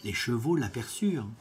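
ordinary delivery, nothing marked

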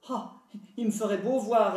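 loud, scornful refusal; spoiled sister snapping at her mother, loud and scornful; rude retort. A narrator's reading. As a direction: bark it back like a spoiled brat. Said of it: "répondit la brutale".

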